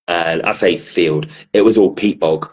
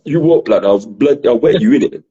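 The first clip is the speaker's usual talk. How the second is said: higher-pitched street threat, hammed-up; mock Southern hard man; 6 semitones higher, 3 dB louder than usual. Touchy Southerner reacts to a wrong word, mock threat. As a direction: take exaggerated offence in a put-on voice.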